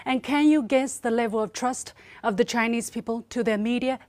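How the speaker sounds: usual speaking voice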